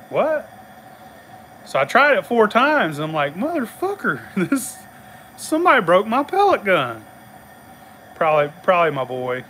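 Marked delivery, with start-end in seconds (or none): 0.1-0.5 high-pitched baffled "what"; retold disbelief. Man whose pellet gun won't fire, incredulous. Reacting to gun not firing. 3.3-7.1 higher-pitched mock outrage; retold exasperation. Cursing his broken pellet gun, mock exasperated.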